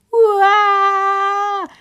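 high loud wailing cry; a toddler's howling wail; baby wail. A narrator's reading. Little Tiki Taka bawling in the kitchen, loud wail. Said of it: "Tiki Taka weinte".

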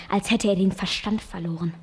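ordinary talk

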